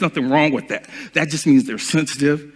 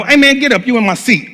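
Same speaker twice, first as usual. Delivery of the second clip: a bit louder and higher, blunt and rude; rude demand; 7 semitones higher, 3 dB louder, faster than usual. Shown as a demonstration. Asking a stranger for the seat, bluntly rude. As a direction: bark it like you own the seat. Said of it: "Same terminology, different phrase".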